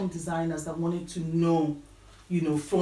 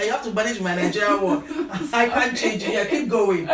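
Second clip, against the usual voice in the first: laughing while quoting herself; 3 semitones higher, 6 dB louder than usual. Retold or imitated. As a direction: quote it through laughter. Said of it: "I told her, I said".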